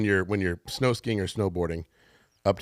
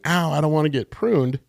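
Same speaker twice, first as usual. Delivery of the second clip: lightly voiced reluctant tree, higher pitch; whiny tree; 7 semitones higher than usual. Speaker playing a tree that resists pruning, whiny. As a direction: complain in a raised voice like a tree dodging pruning.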